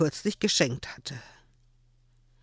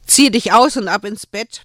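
put-on firm order to a child; brisk bedtime command; 6 semitones higher, 10 dB louder than usual. A narrator's reading. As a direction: tell him off to bed, firmly. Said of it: "befahl Joel".

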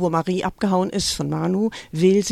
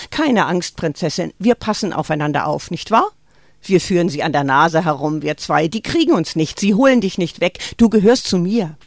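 louder, intense mother confiding to her daughter; a mother warning her girl against the world, louder and urgent; Greta urging; 5 dB louder than usual. Acted. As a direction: press it on her like a secret truth. Greta reassures frightened Marie.

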